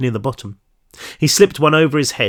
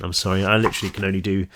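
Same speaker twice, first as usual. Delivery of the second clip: plain reading voice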